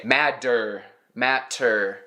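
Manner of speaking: "matter" with a hard aspirated T; overstressed T. Retold or imitated. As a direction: mimic learners who hit the T in "matter" too hard. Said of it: "more emphasis than a British person would".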